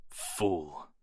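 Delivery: a quiet, contemptuous mutter. Acted. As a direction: spit the word under your breath. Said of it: "muttered the major".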